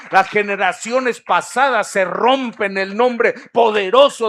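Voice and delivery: loud, high climactic preaching; preaching at full force. Acted. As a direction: drive the promise home at the top of the voice. Preaching climax, applause call.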